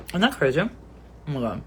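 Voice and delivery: normal talking voice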